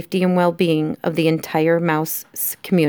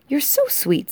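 cooing princess; 9 semitones higher than usual. Acted. Princess admires the tiny mouse, cooing.

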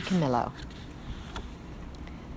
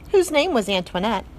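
ordinary delivery

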